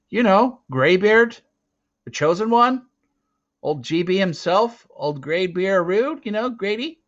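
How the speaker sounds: put-on high, loud, anxious boy rambling; scared boy grasping for names, high and loud; nervous pleading. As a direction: babble out excuses in a panic. Boy desperately justifies himself.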